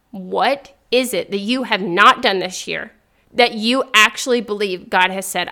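usual speaking voice

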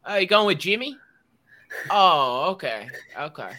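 high, loud put-on Baltimore accent. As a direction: lean into the Baltimore Orioles-fan accent. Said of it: "my Baltimore Orioles accent".

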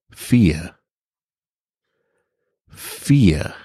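plain reading voice

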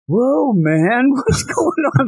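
high, dazed "whoa, man"; stoner teacher. Retold or imitated. Stoned teacher entering the classroom, high and dazed. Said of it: "he's like".

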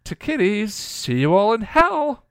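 high, loud villainess jeer; drawn-out, shrill-pitched parting taunt. A narrator's reading. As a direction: crow it in gleeful triumph. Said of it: "Glory taunted".

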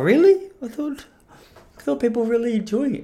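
high-pitched mock disbelief; surprised. Podcaster retells hearing criticism of his show, high and incredulous. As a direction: act taken aback, pitch jumping up.

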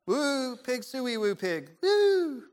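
high, half-hearted sports-fan whoop; badly faked team chant ending in a high woo; trying to sound sporty. Imitating people-pleasers around sports friends, faking sporty. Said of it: "try to sound sporty".

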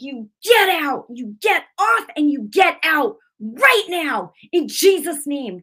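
high, loud, drawn-out command; mad rebuke. Retold or imitated. Woman casting out the devil in Jesus' name, angry rebuke. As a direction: order it out with full force. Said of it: "Just like that".

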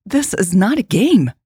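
interjecting. A narrator's reading. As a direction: jump in with mild protest. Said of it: "Jade interjected before Michelle could say".

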